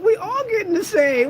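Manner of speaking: high, loud teasing; excited shout. Real, not acted. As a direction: shout it out laughing. Laughing shout.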